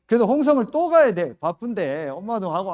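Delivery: fed-up pastor retelling; exasperated. Retold or imitated. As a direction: blurt out the frustration. Angry retelling of repair trouble.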